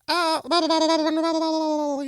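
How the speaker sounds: high garbled blah-blah babble; squeaky Animal Crossing gibberish; garbled robot voice. Shown as a demonstration. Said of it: "a robot voice that's like".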